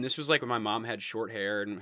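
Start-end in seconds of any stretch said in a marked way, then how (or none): none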